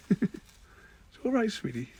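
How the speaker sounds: slow, high, drawn-out reassurance; soothing pet talk. Real, not acted. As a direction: coo it slowly to calm the cat. Soothing her cat.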